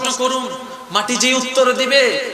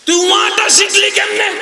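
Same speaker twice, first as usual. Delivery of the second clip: put-on calling out to baby; 6 semitones higher than usual. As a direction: shout down at the newborn. Shouting at the baby.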